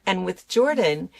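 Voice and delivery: ordinary delivery, nothing marked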